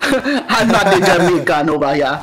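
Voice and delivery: put-on laughing through a Jamaican accent. New kid protesting to classmates, laughing Jamaican accent. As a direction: play up the Jamaican patois, amused. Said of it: "they thought I was Jamaican".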